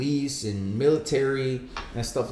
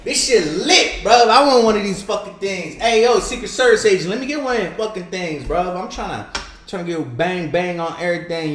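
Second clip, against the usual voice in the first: loud, high, hyped-up mock Biden; Joe Biden; 7 semitones higher, 8 dB louder than usual. Mimicking the president thrilled by a rifle, loud and high. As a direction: ham it up like a kid with a new toy. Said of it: "and be like".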